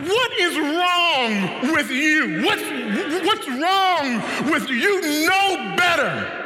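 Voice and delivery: mock scolding of a naughty child; exasperated parent. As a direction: drag it out like a fed-up parent. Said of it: "We say things like".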